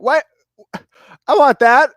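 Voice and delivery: high, loud disbelieving craving; hammed-up excited yelp; excited mock outburst. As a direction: burst out loud and high, wanting it. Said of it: "And I'm like".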